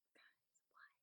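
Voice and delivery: hushed mock bewilderment; whispered disbelief. Aunt answering her whispering nieces, whispered. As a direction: whisper it, baffled.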